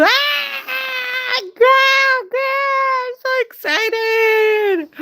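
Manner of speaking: very high, drawn-out shriek of excitement; excited squeal. Real, not acted. Streamer thrilled with her viewers, excited high squeal. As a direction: squeal it, stretching the last words.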